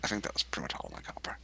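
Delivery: fast whispered mumble; whispering. Real, not acted. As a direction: whisper it quickly to yourself. Quiet aside.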